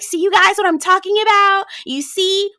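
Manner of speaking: high, loud mock protest; Lisa. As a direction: pitch it way up, pleading her case to the group. Voicing Lisa's aggrieved reaction.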